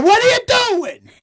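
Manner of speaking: very high loud startled yell; slapped awake by his kid; hammed-up high-pitched freakout; startled outrage. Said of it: "I went".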